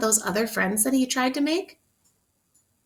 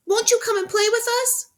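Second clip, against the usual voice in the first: pleading rabbits; 12 semitones higher than usual. Acted. Rabbits begging the butterfly to play, high pleading. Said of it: "said the rabbits".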